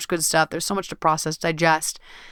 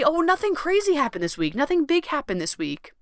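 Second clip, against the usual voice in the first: much higher, breezy shrug of a voice; dismissive brush-off; 7 semitones higher than usual. Retold or imitated. Imitating listeners shrugging off their week, airy and high. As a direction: wave it away lightly. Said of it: "we may say".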